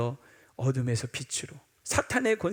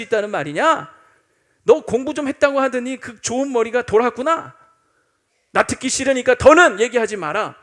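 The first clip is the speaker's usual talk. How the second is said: sharp scolding rebuke, louder; snapping angrily; 4 semitones higher, 6 dB louder than usual. Acted. Festus lashing out at Paul, angry scolding. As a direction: tear into Paul with contempt. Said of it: "막 쏘아붙이는 거예요".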